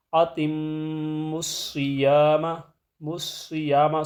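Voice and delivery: الصِّيَامَ with a full, heavy ص, slowly; slow tajweed demo; heavy saad. Shown as a demonstration. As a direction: make the ص in الصِّيَامَ thick and full. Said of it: "صاد کو پر کر کے پڑھنا ہے".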